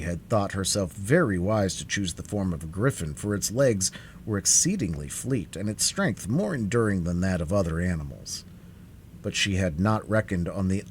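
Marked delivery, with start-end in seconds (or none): none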